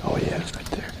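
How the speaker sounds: soft hushed whisper; barely voiced, keep it hidden; wildlife watching whisper. Real, not acted. Hunters hiding from nearby deer.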